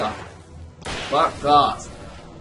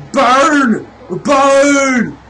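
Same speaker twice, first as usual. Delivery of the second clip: loud, higher battle shout; attacking yell; 7 semitones higher, 6 dB louder than usual. Pretend. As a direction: yell it at the enemy as you attack. Attacking with the light.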